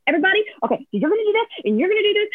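high, fast order-giving; mock brisk pep talk under stress; imitated frantic rallying. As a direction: rattle out instructions in a high voice. Retold frantic rallying of team.

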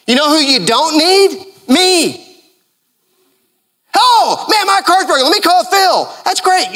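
high-pitched, worked-up preacher acting out a car trouble; animated comic preaching. Animated preaching with acted car example.

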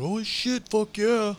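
high, thrilled crowd cheer; excited shout. Retold or imitated. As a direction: cheer it like a hyped fan. Crowd's thrilled reaction retold.

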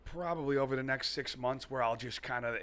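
ordinary talk, nothing marked

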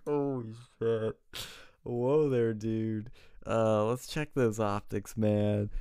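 slow, startled "whoa there, dude"; backing off nervously, drawn out; alarmed whoa. Retold or imitated. Canvasser startled by a voter's offer to kill, slow and alarmed. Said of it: "I'm like".

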